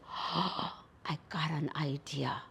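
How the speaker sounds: hushed, breathy whisper to himself; whispered aside. Acted. Coyote whispering his plan to himself. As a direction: whisper the idea like a secret.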